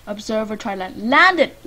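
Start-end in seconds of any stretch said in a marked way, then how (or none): 1.1-1.6 loud, urgent yell; excited shout. Real, not acted. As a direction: yell it at the screen. Urging the game character to land.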